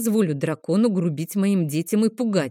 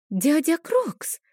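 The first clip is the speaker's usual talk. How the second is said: put-on much higher, slow, eager child cry; sudden girl's exclamation; 10 semitones higher, slower than usual. Girl calls out to the dragon, high excited cry. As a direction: call out in sudden joyful recognition. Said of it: "внезапно воскликнула Адель".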